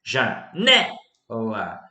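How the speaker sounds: Janela in slow separate syllables, NE loud; stress on penultimate syllable. Shown as a demonstration. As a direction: split Janela and punch the NE. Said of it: "sílaba tônica na penúltima sílaba". (Janela silabado, com força no NE)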